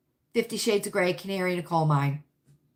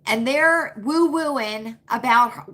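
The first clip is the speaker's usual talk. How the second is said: ordinary delivery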